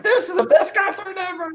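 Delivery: high-pitched, laughing gush; excited squeal. Retold or imitated. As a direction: squeal it out in sudden delight. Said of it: "he's like".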